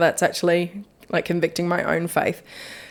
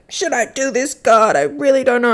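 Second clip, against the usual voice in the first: higher-pitched, put-on wavering question; imitated uncertain pleading; 6 semitones higher than usual. Podcaster retelling her doubtful prayer to God, mock pleading. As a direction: retell an unsure plea to God.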